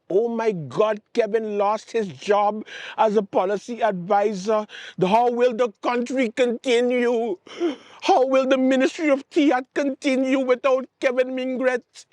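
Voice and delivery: high, hammed-up mock panic; mock dramatic lament. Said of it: "making a scene and saying".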